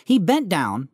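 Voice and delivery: usual speaking voice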